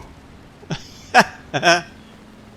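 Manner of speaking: high, rising burst of real laughter; laughing at the thrill; delighted laugh. Real, not acted. Gamer laughing after a wild flight, delighted.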